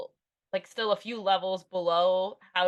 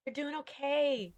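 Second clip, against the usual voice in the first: reassuring; 6 semitones higher, 3 dB quieter than usual. Retold or imitated. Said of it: "and I'm going".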